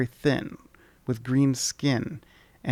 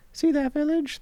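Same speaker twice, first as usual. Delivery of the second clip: much higher, squeaky sprite voice; charming tiny creature. Acted. Tiny creature charmingly greeting the traveller. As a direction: chirp it like a tiny fairy. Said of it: "the creature replies".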